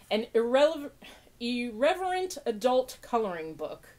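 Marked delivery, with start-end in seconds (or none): none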